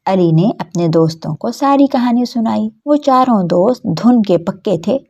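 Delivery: plain reading voice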